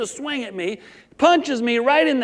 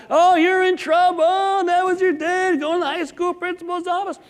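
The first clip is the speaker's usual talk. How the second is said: mocking taunt; 9 semitones higher than usual. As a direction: rub it in like a schoolyard kid. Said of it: "all the kids are giving me a hard time".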